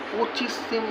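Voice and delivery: stunned, barely voiced disbelief; taken aback. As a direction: imitate someone caught off guard, very softly. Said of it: "ও প্রথমে হকচকিয়ে গেছে".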